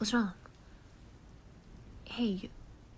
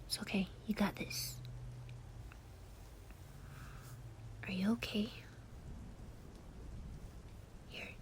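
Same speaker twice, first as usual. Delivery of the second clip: hushed, tender soothing to a friend in tears; calm them down gently, mostly whispered; comforting whisper; 5 dB quieter than usual. Acted.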